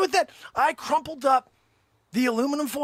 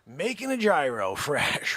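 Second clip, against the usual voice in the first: normal talking voice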